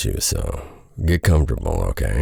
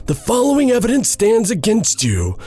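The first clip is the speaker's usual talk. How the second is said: much higher, stern accusing judge voice; stern pastor; 14 semitones higher than usual. Acted. Pastor Isaac accusing Henry before the church, high stern voice. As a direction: lay out the charges like a verdict.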